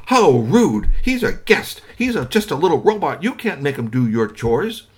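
high, loud scandalized scolding; indignant mother. Acted. Mother scolding her son about the robot guest, high and loud. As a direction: tell him off for his rudeness.

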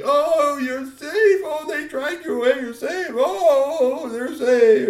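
high, loud weeping with relief; sobbing relief. Acted. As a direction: cry over her like a frantic dad. Father hugs rescued daughter.

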